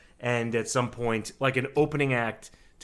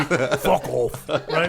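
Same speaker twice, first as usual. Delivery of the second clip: put-on British accent; 3 semitones higher, 5 dB louder, much slower than usual. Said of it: "Why is that your British accent".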